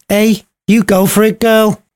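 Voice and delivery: high, cheeky cheer; cheering nurse. Acted. Nurse cheering Juliet on, high cheeky voice. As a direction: egg her on like a saucy nurse. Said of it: "came the cry from the nurse".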